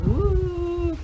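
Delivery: high howled woo like a coyote; a sudden high coyote woo; coyote cry. Retold or imitated. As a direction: imitate a coyote crying out. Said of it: "when he's crying".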